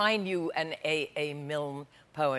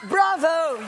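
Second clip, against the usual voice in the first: cheering; 9 semitones higher, 4 dB louder, slower than usual. Real, not acted. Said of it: "celebrating end".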